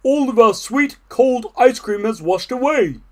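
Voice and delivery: loud, high storybook lament; dismayed Toad. A narrator's reading. Toad to Frog after falling in the pond, dismayed. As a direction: bemoan the lost treat.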